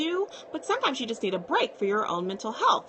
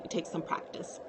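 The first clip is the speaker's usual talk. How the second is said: whispered aside; 5 semitones lower, 9 dB quieter than usual. Real, not acted. Soft aside repeating point.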